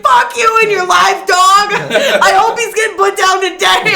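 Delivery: half-laughing mock rage at a stranger; retold furious yell, laughing; angry outburst. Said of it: "I was so angry".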